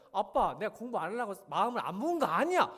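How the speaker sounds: put-on child talking back to dad about studying; kid protesting. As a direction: argue back at dad like a sulky teen.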